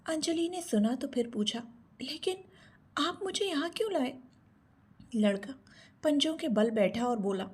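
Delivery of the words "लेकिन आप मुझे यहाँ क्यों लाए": put-on puzzled captive girl. A narrator's reading. Captive girl questions her captor.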